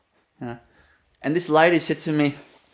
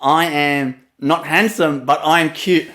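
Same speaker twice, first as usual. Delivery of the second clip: slow translation; slower than usual. Retold or imitated. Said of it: "That means I say".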